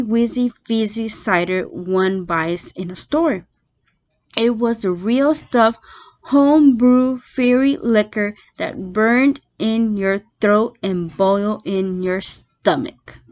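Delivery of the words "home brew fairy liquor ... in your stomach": plain reading voice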